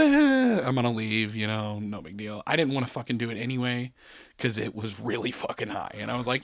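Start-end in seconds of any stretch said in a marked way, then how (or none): none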